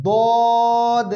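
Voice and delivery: দদ heavy, full and drawn out; thick দদ. Shown as a demonstration. Teacher showing the heavy letter, thick drawn-out দদ. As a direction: fill the mouth for a thick, long দদ. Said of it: "দদ মোটা হবে".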